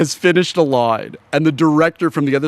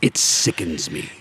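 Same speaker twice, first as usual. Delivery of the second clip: slow, whispered distaste; whispered mock disgust; 10 semitones lower, 4 dB quieter than usual. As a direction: whisper it slowly as if it turns your stomach. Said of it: "And Shatner goes".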